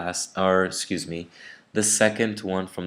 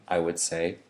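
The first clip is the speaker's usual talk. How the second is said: normal talking voice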